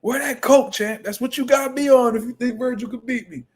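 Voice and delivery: high-pitched heckler taunting the champ; crazy trash talk. Acted. Streamer acting out taunting De La Hoya, crazy trash talk. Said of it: "in that bitch talking crazy".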